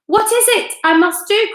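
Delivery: high, loud desperate cry. A narrator's reading. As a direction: cry out in panic. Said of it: "cried Missus Twit".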